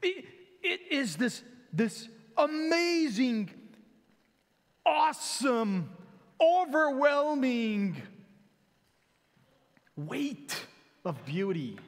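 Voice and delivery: slow, high, swelling praise; awestruck preaching. Acted. Preacher to congregation, slow swelling awe. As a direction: build the wonder word by word, then settle.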